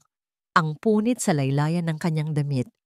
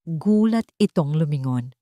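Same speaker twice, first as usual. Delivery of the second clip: usual speaking voice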